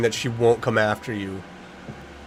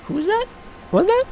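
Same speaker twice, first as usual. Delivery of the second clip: startled; faster than usual. Real, not acted. Startled by voice in game.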